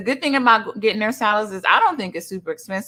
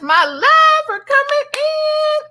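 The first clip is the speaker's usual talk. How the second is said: high, louder, drawn-out sing-song; excited welcome; 5 dB louder, slower than usual. Real, not acted. Streamer thanking a viewer for coming in, excited.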